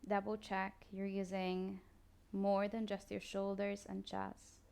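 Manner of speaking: soft, slow body-scan guidance with long pauses; guided meditation. Acted. Yoga teacher guiding listeners through a body scan, calm meditation voice. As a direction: ease the listener into stillness, unhurried.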